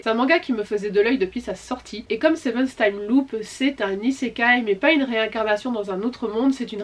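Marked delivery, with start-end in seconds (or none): none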